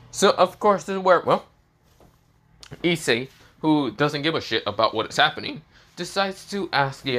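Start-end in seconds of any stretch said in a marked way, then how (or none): none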